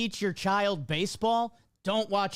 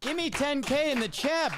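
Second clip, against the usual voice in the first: hype; 8 semitones higher than usual. Real, not acted. Hyping up the chat.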